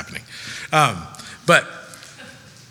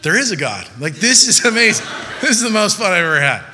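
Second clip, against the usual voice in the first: higher, louder, laughing delight; thrilled; 6 semitones higher, 4 dB louder, faster than usual. Retold or imitated. Kid watching a lemon fly, laughing in thrilled delight. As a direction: shout with joy at the launch. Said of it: "you're like".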